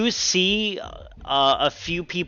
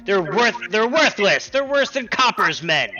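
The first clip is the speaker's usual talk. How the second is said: higher-pitched, mildly heated villager's cry; 5 semitones higher than usual. Acted. Angry townsperson complains about the guards, half crying out. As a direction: call out a complaint from the crowd. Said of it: "kinda cry out".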